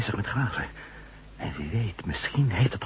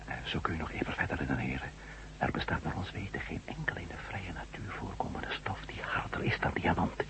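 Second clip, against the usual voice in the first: awed whisper; 4 semitones lower, 10 dB quieter than usual. Acted. Matt whispers to the doctor that someone made this, awed whisper. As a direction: whisper the dreadful conclusion.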